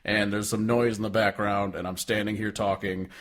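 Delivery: droning imitation of a bored singer; monotone. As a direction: recite it on one note, not caring. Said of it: "he was doing a monotone".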